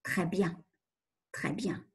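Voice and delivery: the unconscious quietly approving her; inner voice. Pretend. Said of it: "l'inconscient me dit".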